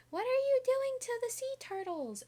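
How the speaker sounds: high, squeaky worried-person voice; shrill mocking squeal; annoying voice. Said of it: "sorry for the annoying voice".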